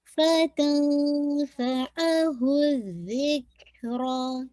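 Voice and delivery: drawn-out chanted recitation; slow Quranic recitation. Shown as a demonstration. Teacher reciting slowly for student. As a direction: recite slowly, tajweed style. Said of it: "हल्का सा air blow कीजिएगा".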